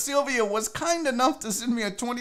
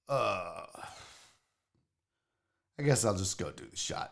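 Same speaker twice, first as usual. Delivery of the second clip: low, quiet, dopey mumble, lower and softer; imitating the guy; 13 semitones lower, 12 dB quieter than usual. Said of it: "looked at the door and goes".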